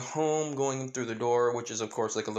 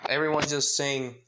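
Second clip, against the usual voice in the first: ordinary delivery, nothing marked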